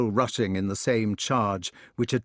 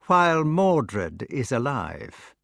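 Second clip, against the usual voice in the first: slow, bitter finishing line; the pale son echoing bitterly; bitter drama; 3 semitones higher, slower than usual. Acted. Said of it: "with the bitter drama of a perfect cue".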